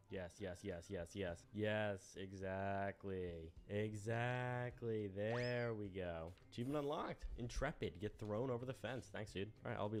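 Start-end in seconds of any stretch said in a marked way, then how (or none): 1.5-6.3 slow, savoured exactly; drawn-out satisfaction. Acted. Player savouring the fence throw, slow and drawn out. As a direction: stretch each word out, very pleased.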